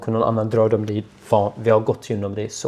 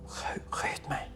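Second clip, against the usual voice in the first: slow, breathless whisper; 18 dB quieter, much slower than usual. Retold or imitated. Prisoner answering the guard, whispering. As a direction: barely whisper it out. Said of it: "du viskar ju".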